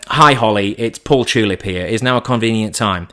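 usual speaking voice

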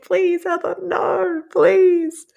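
high, whiny mock begging; the listener groaning in mock despair; mock pleading listener. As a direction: beg her not to tell the joke. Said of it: "You're like".

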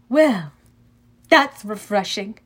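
put-on lightly British-accented dry remark; strange British-ish accent. A narrator's reading. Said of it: "British maybe".

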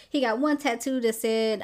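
usual speaking voice

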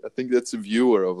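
ordinary delivery

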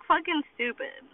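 normal talking voice

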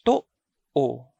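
"to-o." with the first vowel stopped short, the second one normal; glottal catch. Shown as a demonstration. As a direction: break "to-o." in two with a silent catch in the throat. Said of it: "so it is stopped, while the second vowel is a normal vowel".

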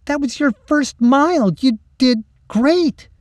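high, loud, enthusiastic praise; insistent, high-pitched pep talk; emphatic encouragement. Acted. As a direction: cheer your friend on, stretching the praise. Cheering up a friend after race.

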